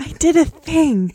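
high, slow, meekly proud; Fluttershy. Retold or imitated. Host mimicking Fluttershy's tiny contribution, high meek pride. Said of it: "Fluttershy is the epitome of".

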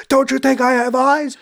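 high, loud hammed-up retort; over-the-top imitated outburst; mock outraged yell. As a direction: shout it in exaggerated indignation. Acting out a heated retort.

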